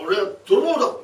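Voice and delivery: loud, proud thief's announcement; loud declaration. Acted. A thief proudly announcing himself to the tenant, loud. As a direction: boom out the claim.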